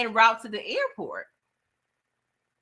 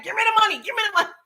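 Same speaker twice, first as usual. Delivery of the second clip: higher, pleading and demanding at once; desperate demand; 10 semitones higher, much faster than usual. Retold or imitated. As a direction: beg for the money, pushed higher. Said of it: "this when he becomes desperate".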